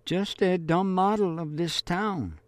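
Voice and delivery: brushing it off lightly; girl's voice. Acted. Marie-Laure recalls her answer.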